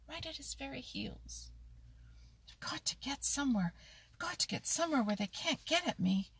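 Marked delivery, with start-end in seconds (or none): 2.5-6.3 high, fast, frantic sobbing; a touch of sobbing from a fleeing mouse. A narrator's reading. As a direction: plead desperately, nearly in tears. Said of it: "he sobbed".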